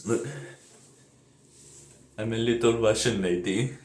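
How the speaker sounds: softly voiced attempt at a Russian lady; mock Russian accent. Vlogger clowning as a little Russian lady, mock Russian accent. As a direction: put on a shaky-grasp Russian accent, quietly. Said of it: "I don't know what accent I'm doing".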